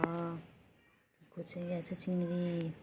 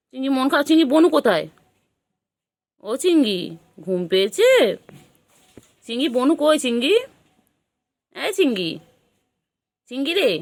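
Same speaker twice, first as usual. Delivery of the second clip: loud sing-song calling for the pet; 4 semitones higher, 15 dB louder than usual. Real, not acted. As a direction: call the pet loudly from far, high and louder. (পোষ্যকে জোরে ডাকছে)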